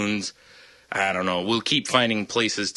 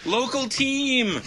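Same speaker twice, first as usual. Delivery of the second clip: mock crowd cheer; much slower than usual.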